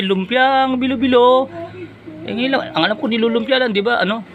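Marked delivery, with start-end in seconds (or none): 0.0-1.5 slow, high sing-song; vendor call. Retold or imitated. Imitating the vendor's call, slow and high. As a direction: stretch out the vendor's cry.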